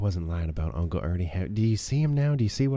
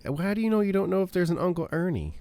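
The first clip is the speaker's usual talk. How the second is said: teasing; 10 semitones higher, 6 dB louder than usual. Real, not acted. Teasing argument with other player.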